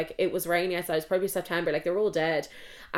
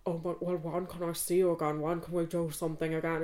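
imitating Rory; 5 dB quieter than usual. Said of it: "And he was like".

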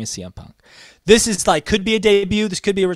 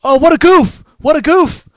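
high, loud mock goof-off cry; loud, high retold wrestling-promo bit; imitating Arn; 12 semitones higher, 10 dB louder than usual. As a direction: ham up a high yelp at yourself. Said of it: "Arn goes".

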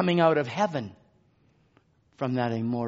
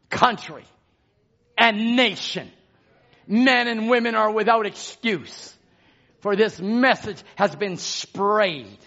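loud, drawn-out proclamation; exultant preaching; 6 semitones higher, 9 dB louder, slower than usual. Real, not acted. Preacher declares the message has gone round the globe, loud and exultant. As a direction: ring out each phrase with pauses between.

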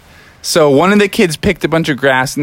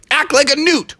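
higher command; 11 semitones higher than usual. Acted. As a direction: order the donkey. Giving a command.

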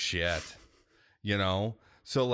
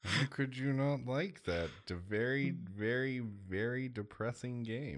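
sarcastic; 4 semitones higher than usual. Acted. Mock outrage at friend.

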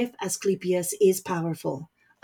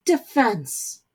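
fiery retort, a bit louder; 3 semitones higher, 3 dB louder than usual. Acted. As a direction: spit the word out with fire. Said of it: "but fire in his mother's tirade".

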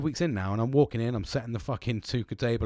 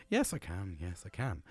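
resigned agreement; 5 semitones lower, 8 dB quieter than usual. Retold or imitated. Retold resigned answer to repair bill.

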